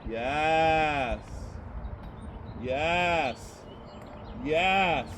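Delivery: loud, rising cheer of yes; excited praise. Real, not acted. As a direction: cheer her on. Praising the horse's march.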